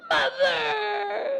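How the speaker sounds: high, loud cry for mom; whiny tattletale yell. Retold or imitated. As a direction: wail for mother like a tattling kid. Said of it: "he will do this".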